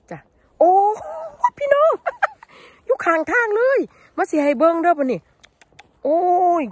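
high, loud thrilled cry; excited squeal. Real, not acted. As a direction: shriek with delight at the find. Finds mushrooms by the path.

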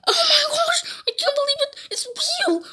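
high, squeaky disbelief; play-acted shock. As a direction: gasp it out high in mock horror. Shocked that zombies are real.

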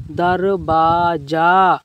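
दरवाजा blended into one drawn-out word; slow reading. Shown as a demonstration. Teacher showing friends how to read, slow reading. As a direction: stretch the syllables of दरवाजा, joined up. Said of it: "इस तरीके से दोस्तों धीमे धीमे पढ़िए".

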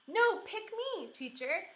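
high, slow shout for the teacher; shouting boy. A narrator's reading. Polly Allen Puffer shouting at the teacher to pick him. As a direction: shout over the other boy. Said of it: "shouted another boy".

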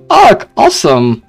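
ordinary delivery

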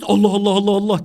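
loud, hurried mock war chant; quick, forceful imitation of soldiers yelling; imitated battle cry. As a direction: shout it like troops charging, in jest. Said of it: "diye bağırıyoruz ya".

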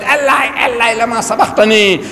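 crying out. Retold or imitated. Jesus on the cross calling to God, crying out. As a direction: call up to God in mild anguish. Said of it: "he cried".

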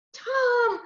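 put-on high, pleading child's call; worried call. Worried sister calling to brother, high plea. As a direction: draw the name out high.

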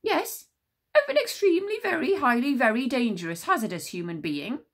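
very high, rising loud voice; squeaky, overexcited official; high excitable commander. A narrator's reading. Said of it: "said the Druid commander".

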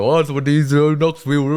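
lightly voiced, slow, drawled commentator hello; Booker T greeting. Booker T greeting Knoxville, slow and lightly put-on. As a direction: greet the town slowly, like a commentator.

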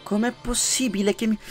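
very high, loud disbelief; surprised outburst. Real, not acted. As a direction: shout in disbelief at the screen. Something unexpected happens in-game. (sorpresa incredula)

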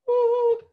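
a sudden very high, loud vocal squeak; high note demo. Shown as a demonstration. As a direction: squeak out a high note to show what agudo sounds like. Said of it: "Una voz agudo es, o alto es".

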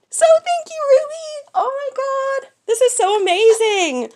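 high, loud squeals of delight; excited squeal. Real, not acted. Opening Ruby's gift box, squealing high and loud. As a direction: squeal with joy over the gift.

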